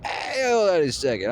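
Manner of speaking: casual stall. Pretend. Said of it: "I'm like".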